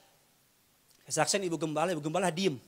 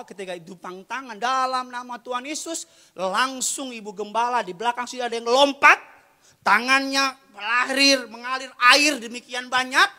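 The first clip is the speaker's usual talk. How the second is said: excited testimony, swelling louder; building preaching; 9 semitones higher, 13 dB louder than usual. Real, not acted. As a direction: rise steadily in pitch and volume.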